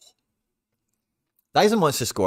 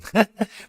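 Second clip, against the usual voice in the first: short high laugh; 11 semitones higher, 3 dB louder than usual. Real, not acted. Laughs at his own chances.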